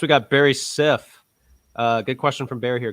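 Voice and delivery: usual speaking voice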